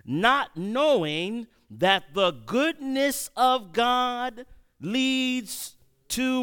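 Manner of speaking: slow, building scripture read, rising high toward God. Acted. Preacher reads Romans to the congregation, slow and rising. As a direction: lift the verse slowly to its peak.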